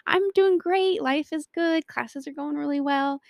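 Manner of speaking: high, loud mock-cheerful reply, easing off; breezy cheer. Boyfriend texting back that all is fine, breezy cheer. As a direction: chirp it like a carefree texter.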